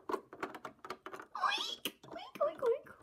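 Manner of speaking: high squeaky piggy oinks. Acted. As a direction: squeal it like a little pig. Pig answering in play.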